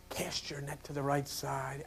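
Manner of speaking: hushed whisper. Acted. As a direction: whisper the teaching as a secret. Quoting teaching softly.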